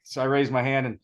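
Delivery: ordinary talk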